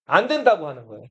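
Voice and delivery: ordinary delivery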